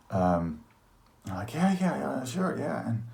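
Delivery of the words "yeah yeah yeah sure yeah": high, rattled-off retold yeses; flustered agreement. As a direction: agree hastily in a raised voice. Acts flustered agreement about his mom.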